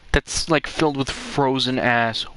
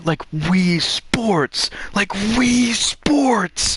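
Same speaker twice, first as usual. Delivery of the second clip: very slow, stretched mock cry; slow-motion acted outburst; drawn-out exclamation; 8 semitones higher, much slower than usual. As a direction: stretch each word way out, rising higher. Acts out panicked thought, stretched words.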